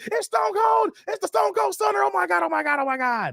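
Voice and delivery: shrieking high commentator yell, fading at the end; Jerry Lawler call. Retold or imitated. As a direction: scream it like the ringside announcer seeing the stunner. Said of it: "He said".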